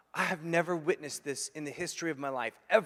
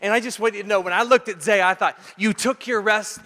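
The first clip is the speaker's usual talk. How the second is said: higher, louder preaching build; fervent preaching; 5 semitones higher, 11 dB louder than usual. Acted. Preacher telling the congregation winning matters, full force. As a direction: drive the point home from the pulpit.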